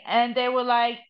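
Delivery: usual speaking voice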